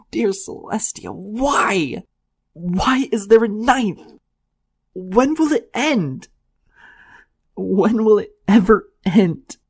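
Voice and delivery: anguished wail. A narrator's reading. Unicorn wails into the grass, high slow anguish. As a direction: cry out to the heavens in anguish. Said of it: "My anguished voice".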